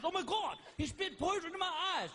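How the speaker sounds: high-pitched mock panic; hammed-up alarm, pitch jumped up; Steve Irwin freaking out. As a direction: yelp it as a stricken wildlife host. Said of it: "Steve freaked out".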